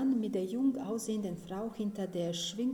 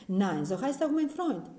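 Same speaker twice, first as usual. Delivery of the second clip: louder, amazed at the coincidence; astonished; 2 semitones higher, 8 dB louder than usual. A narrator's reading. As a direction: blurt it out in disbelief. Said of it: "Verblüfft".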